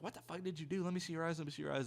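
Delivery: imitating an insistent classmate, pitched high; demanding classmate. Christina wants to see his eyes, mock high-pitched demand. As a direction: put on a high voice for a classmate's startled questioning.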